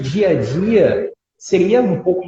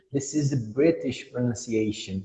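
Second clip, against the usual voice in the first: put-on British accent; 3 semitones lower, 7 dB quieter than usual. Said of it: "ele fala, ó".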